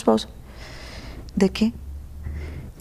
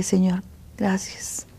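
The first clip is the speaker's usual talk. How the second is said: whispered thanks; 6 dB quieter, slower than usual. Retold or imitated.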